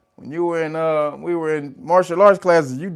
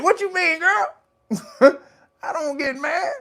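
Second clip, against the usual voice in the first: high squeaky protest, much higher, breaking into laughter; mock indignant falsetto; 13 semitones higher, slower than usual. Streamer protests to chat that he never gets mad, in a squeaky high voice. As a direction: squeal the denial like a wounded innocent. Mocking denial, third round.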